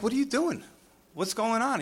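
high-pitched, put-out questioning; mildly hammed-up bewildered complaint; mock exasperation. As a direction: imitate someone upset at being bothered. Acted retelling of upset Samuel's rebuke.

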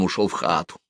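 ordinary delivery

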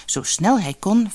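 ordinary delivery, nothing marked

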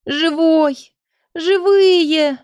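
high, tearful cry of relief; sobbing with joy. A narrator's reading. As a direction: burst out crying with joy over the rescued one. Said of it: "разревелась".

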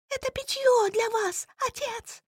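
put-on high, thin young woman's voice; thin girlish voice. Daughter voiced in story.

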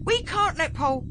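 high protest, cut off mid-word. A narrator's reading. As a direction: object urgently until interrupted. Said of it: "protested Ben".

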